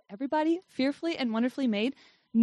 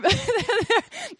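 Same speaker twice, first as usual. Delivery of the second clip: a sudden high bright laugh; burst of high laughter; 9 semitones higher, 4 dB louder than usual. Real, not acted. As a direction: laugh out, caught off guard. Laughs mid-sentence about boundaries.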